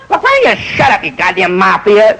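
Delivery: high, sharp put-down; irritated heckle. Retold or imitated. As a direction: snap at the table. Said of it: "Shelley comes up with a line".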